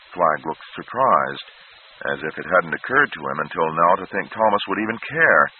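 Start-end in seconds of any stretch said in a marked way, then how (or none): none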